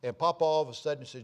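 ordinary talk

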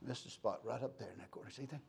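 low, very soft boss muttering; inspecting boss. Retold or imitated. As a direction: imitate a boss quietly pointing out flaws. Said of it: "he'd always come in like this".

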